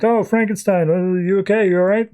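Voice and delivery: mild mock alarm, voice lifted; acted concern. Rushing to a coughing friend.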